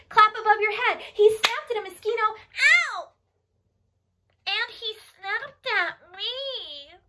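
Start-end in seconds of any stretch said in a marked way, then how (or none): 1.1-3.1 loud, high, quick burst into a yelp; excited snapping. Acted. Showing children the clap overhead, excited snapping. Turtle snaps in action rhyme. 4.5-7.0 high, very slow stretched-out words; playful mock distress. Telling children the turtle snapped at her, mock distress.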